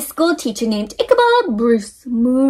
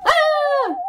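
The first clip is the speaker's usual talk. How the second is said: scared yelp. Acted. Scared by the boo.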